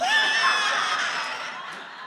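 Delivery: sudden loud laugh, high and bright; shrieking laugh; loud burst of laughter. Real, not acted. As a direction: break out laughing at the timer. Timer went off mid-share.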